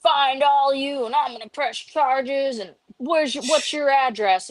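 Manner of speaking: hammed-up angry demand; threatening patient. Patient threatening the nurses with charges, mock angry yell. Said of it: "he's like".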